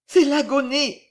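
high, loud cry of dread; terrified. A narrator's reading. Violetta watching the dying woman, high and terrified. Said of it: "murmura Violetta épouvantée".

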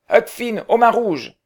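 high, booming pirate name; loud pirate call. Acted. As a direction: boom out the nickname proudly. Pirate boy announcing himself.

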